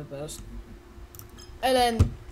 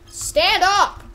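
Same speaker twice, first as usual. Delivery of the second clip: high, loud order; sharp raised-voice demand; exasperated command; 8 semitones higher, 6 dB louder than usual. Pretend. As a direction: yell it in frustration. Player orders a figure to stand.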